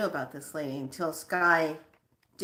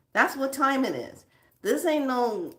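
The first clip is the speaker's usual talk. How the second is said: ordinary talk, nothing marked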